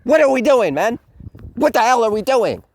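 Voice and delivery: loud, high mock yell of disbelief; exasperated yell. Reviewer reacting to the sudden team-up, exasperated yell. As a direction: bellow it in outrage at the script. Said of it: "And I'm like".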